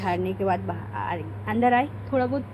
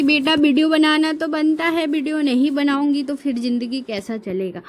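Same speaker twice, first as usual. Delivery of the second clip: raised, louder voice over the noise; loud reply to son; 4 semitones higher, 7 dB louder than usual. Retold or imitated. Mother answering her shouting son over the noise, loud and raised. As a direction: call back to the boy, loud and higher.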